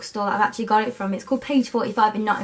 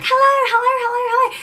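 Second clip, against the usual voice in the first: high squeaky repeated hellos, louder; mock a chirpy greeter with shrill-high hellos; imitating Giovanna; 12 semitones higher, 5 dB louder than usual. Vlogger mocking how Giovanna greets viewers, high squeaky mock hellos. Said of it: "how she was like".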